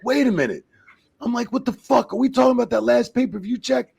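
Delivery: high-pitched retold protest; indignant disbelief. Referee butting in on two wrestlers' pay talk, indignant disbelief. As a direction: act out butting in, incredulous. Acted disbelief at others' big checks.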